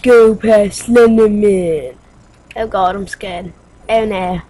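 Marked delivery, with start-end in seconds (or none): 0.0-1.9 loud, slow, drawn-out chant; mock viewers' urging. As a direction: bellow it as the egging-on audience. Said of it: "you guys are just saying".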